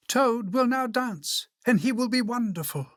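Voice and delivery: high, grand announcer; high-pitched showman's introduction; strange announcer voice. A narrator's reading. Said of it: "said the voice".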